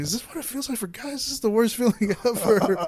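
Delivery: high, fast, incredulous; pained disbelief. Retold or imitated. Telling his friend about the painful night, high and incredulous. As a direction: squeak out the shock. Retelling pain, amazed.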